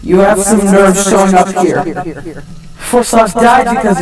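angry accusation. Acted. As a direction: hurl the blame at him. Confronting the villain.

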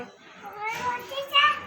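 put-on high, slow lilting prompt for a toddler; slow sing-song prompt. Shown as a demonstration. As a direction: draw it out so the child repeats it. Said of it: "બોલ". (ઊંચા, ધીમા સૂરે લહેકાવીને)

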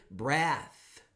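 "Breath" ending on a clear unvoiced TH; unvoiced th demo. Shown as a demonstration. Teacher models the final TH sound. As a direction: close "Breath" on the tongue between the teeth. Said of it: "Você para".

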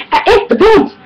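high mock comic character; retelling a funny YouTube bit, high mock voice; imitating Jonas skit. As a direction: quote the skit line high and silly. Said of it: "he was like".

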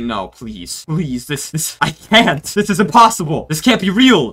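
rising into loud mock panic, a laugh breaking through; mock desperate pleading. Telling the boat staff it can't be real, hammed-up pleading. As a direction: beg them to say it isn't real.

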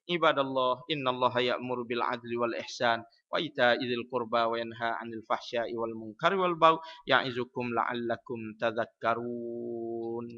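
low, even recitation running straight through; without intonation. Shown as a demonstration. Khatib in a mosque with no intonation, plain low reading. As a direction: read the verse on one low note, drawing out only the last word. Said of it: "Kalau masjid tak ada intonasi".

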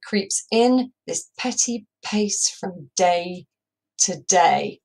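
slow, like footsteps; slow pace. Shown as a demonstration. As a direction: creep it out step by step. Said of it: "still really quite a slow pace".

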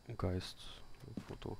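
barely audible while hiding; whispering while hiding. Real, not acted. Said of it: "und sei leise".

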